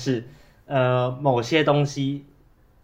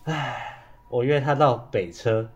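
usual speaking voice

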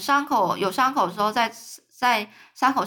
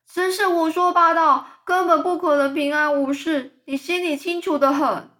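high, loud, panicky cry; nervous outcry; 6 semitones higher, 4 dB louder than usual. Acted. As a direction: shriek it in alarm. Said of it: "很紧张的叫着说". (紧张地尖声大叫)

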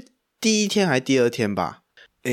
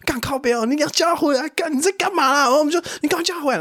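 retold panic; 13 semitones higher than usual. Pranksters panicking, urging call back.

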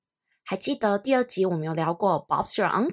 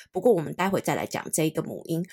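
ordinary talk, nothing marked

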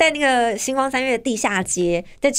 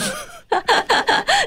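high laugh. Real, not acted. Laughing at own old habit.

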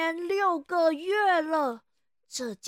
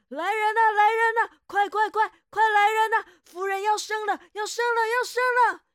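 frantic shout, much higher and louder; alarm shout; 7 semitones higher, 9 dB louder than usual. Acted. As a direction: yell for the servants in panic. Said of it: "大喊着". (惊慌大喊)

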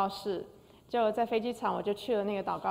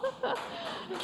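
high delighted laugh; 6 dB quieter than usual. Real, not acted. Laughs at the happy coincidence.

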